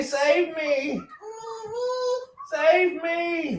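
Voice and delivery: high mock cry; wailing mock plea; fluttering cries for help.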